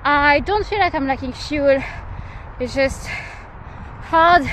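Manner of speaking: while running. Real, not acted. Between hard reps, tired.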